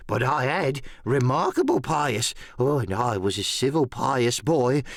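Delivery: a half-mad hermit talking on and on; Ben Gunn. A narrator's reading. Narrator voices Ben Gunn.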